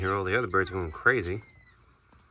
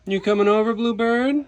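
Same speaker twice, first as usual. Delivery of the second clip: high, loud coaxing call; 12 semitones higher, 6 dB louder than usual. Real, not acted. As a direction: coax the bird closer. Calling a bird over.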